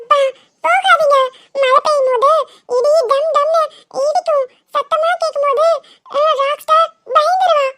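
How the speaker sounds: put-on high, slow sing-song little child's voice; pleading child. Child begging father not to go out in thunder, high pleading voice. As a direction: plead in a high-pitched child's voice, drawing words out.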